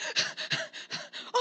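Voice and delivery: exaggerated quick, breath-only huffs; mock panting. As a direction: pant as if chasing someone. Acting out hurrying after the stroller.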